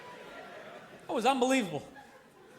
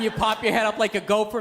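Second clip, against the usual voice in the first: normal talking voice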